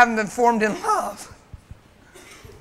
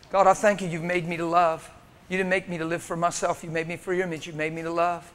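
soft, low prayerful voice; quiet prayer; 3 semitones lower, 5 dB quieter than usual. Acted. Preacher softly praying of his thanks to God. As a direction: pray it softly, close to God.

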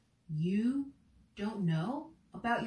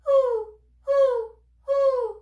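very high, loud short hoots; quick owl puffs; owl breath hoots; 13 semitones higher, 10 dB louder, much slower than usual. Shown as a demonstration. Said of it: "owl breath".

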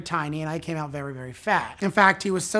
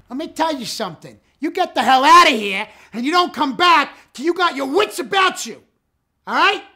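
loud, high, barking mob threat; mafia tough guy; 11 semitones higher, 11 dB louder than usual. Acted. Mafia character throwing someone out, loud and high. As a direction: run him out like a mob boss. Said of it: "I play a lot of mafia characters".

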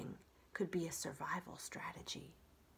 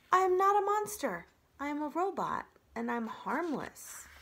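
earnest robot; 8 semitones higher, 4 dB louder than usual. Acted. Robot reassures a frightened opossum.

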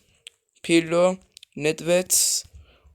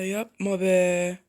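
drawn-out, deflated plea; dragged-out groan of "don't"; slow disbelief; much slower than usual. Real, not acted. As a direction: stretch it out in disbelief. Repeats dismay slowly.

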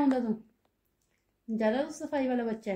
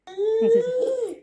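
laughing; 13 semitones higher, 7 dB louder, slower than usual. Real, not acted. Mother laughing with the dogs.

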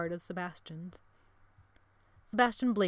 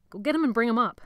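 loud, higher command; urgent order; 5 semitones higher, 8 dB louder, faster than usual. A narrator's reading. Jonathan sending boys for Patrick, urgent order. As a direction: order them off firmly.

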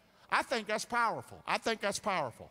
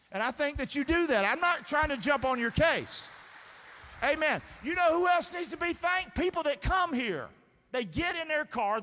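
louder, higher, urgent sermon voice; forceful preaching; 7 semitones higher, 5 dB louder than usual. Real, not acted. Preacher urging congregation to thank newcomers, forceful preaching. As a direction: press the point home with raised voice.

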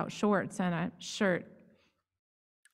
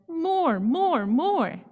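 put-on high, louder eager kid voice; child demanding; 8 semitones higher, 4 dB louder, much slower than usual. A narrator's reading. Child demands more haircut.

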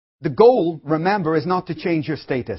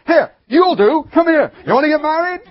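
loud, high and pushy, calling a man over; hammed-up eager woman hunting a husband; eager grab at a husband; 10 semitones higher, 7 dB louder than usual. Acting the eager lady grabbing a man.

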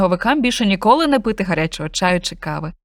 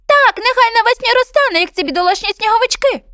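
much higher, louder bird voice; chirpy bullfinch; 14 semitones higher, 5 dB louder than usual. Acted. Bullfinch urges cat and dog to follow, high bird voice. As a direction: chirp it urgently.

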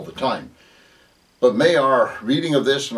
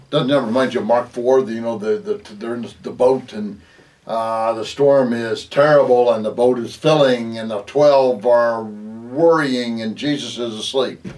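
ordinary talk, nothing marked

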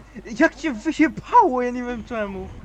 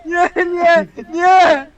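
despairing shout; 5 semitones higher, 8 dB louder, much slower than usual. Real, not acted. Falling again, despairing.

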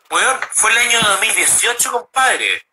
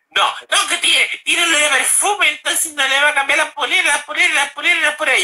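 mocking salesman voice; 9 semitones higher than usual. Salesman mocked in retold quarrel.

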